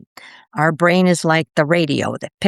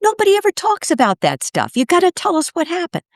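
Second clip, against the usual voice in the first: eager; 11 semitones higher, faster than usual. Retold or imitated. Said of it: "he says".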